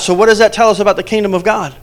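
usual speaking voice

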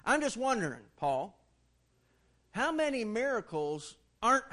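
loud, rising preacher's build to unbelief; full-voice sermon peak on living in unbelief; preaching at full force. Acted. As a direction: drive it home like a revival preacher. Preaching climax.

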